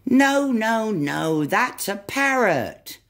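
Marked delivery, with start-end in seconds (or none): 0.1-1.5 upset little monkey protest. Acted. Monkey protests wrong animal.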